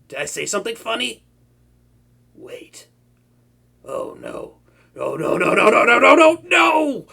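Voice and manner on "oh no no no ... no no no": loud rising string of no's; panicked no. Acted. Villain realizing the hero never came, loud panicked no's. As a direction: spiral into comic panic.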